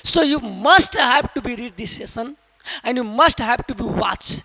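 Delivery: slow, heavy stress on must; slow urging English. Real, not acted. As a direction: press must home, slowly and deliberately.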